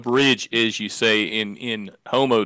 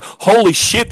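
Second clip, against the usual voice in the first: shocked; 9 semitones higher, 5 dB louder, slower than usual. Retold or imitated. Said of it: "And I'm like".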